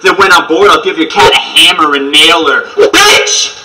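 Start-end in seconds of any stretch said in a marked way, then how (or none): 2.8-3.6 high, breathy shout, no voice; whispered shriek. Acted. Battle rapper capping his verse with a breathy yell. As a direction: hiss it out high and sharp.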